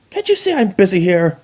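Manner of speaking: high, sharp irritated snap; irritated shout. Acted. Chrysalis snapping at her spy, high and irritated. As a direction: brush off the messenger. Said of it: "Queen Chrysalis shouted".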